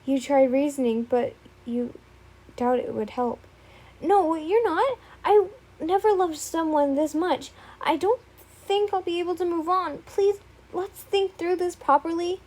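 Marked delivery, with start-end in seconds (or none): 4.0-12.4 higher, urgent desperate plea; desperate pleading. Acted. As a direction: refuse to let her go. Izuku refusing the breakup.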